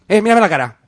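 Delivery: rising into a loud high interrogation; building threat. Acted.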